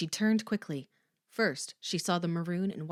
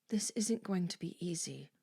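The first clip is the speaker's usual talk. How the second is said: whisper; 5 dB quieter than usual. Acted. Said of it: "she told her daughter".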